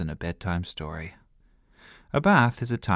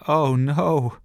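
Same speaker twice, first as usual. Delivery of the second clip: high, loud cry of dismay; alarmed shout; 7 semitones higher, 5 dB louder than usual. A narrator's reading. Little brother shouts as water spills. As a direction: yelp as the cup spills. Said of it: "shouted Sebastian".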